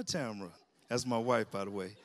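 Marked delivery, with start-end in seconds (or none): 0.0-0.5 name dropped much lower and softer; low falling answer. Acted. Pastor calling his wife, low falling second call. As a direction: bring the second Tamara down low. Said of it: "I like to say".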